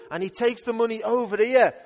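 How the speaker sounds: ordinary talk, nothing marked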